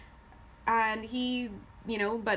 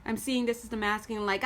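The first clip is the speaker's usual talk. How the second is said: ordinary talk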